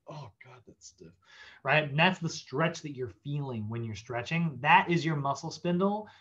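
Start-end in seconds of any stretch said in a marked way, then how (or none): 0.1-1.1 much softer, lower, squeezed-out groan; strained groan. Acted. Stretcher feeling the stiffness, strained soft groan. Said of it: "You're like".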